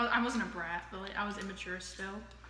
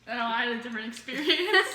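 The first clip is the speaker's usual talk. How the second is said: laughing; 2 semitones higher, 8 dB louder than usual. Real, not acted. Answering through laughter.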